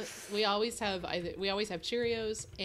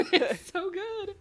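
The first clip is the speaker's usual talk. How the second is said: squealing laugh through the words, higher and slow; 11 semitones higher, much slower than usual. Real, not acted. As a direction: laugh out "It's so good.". Laughing after food praise.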